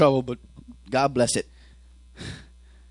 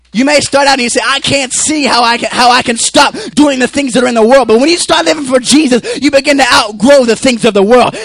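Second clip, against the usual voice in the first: loud, high, sustained sermon shout; full-force preaching; 4 semitones higher, 9 dB louder than usual. Acted. Preacher urging the church to outgrow worldly things, full-force preaching. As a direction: drive every phrase home at full voice.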